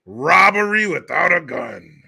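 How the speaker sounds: ordinary talk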